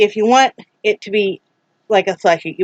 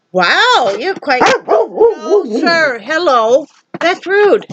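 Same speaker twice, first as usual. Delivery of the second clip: much higher, indignant, drawn out slowly at first; outraged scolding; 7 semitones higher, 4 dB louder, much slower than usual. Real, not acted. Owner scolding her dog for barking back, high and outraged. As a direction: sound personally offended by the dog.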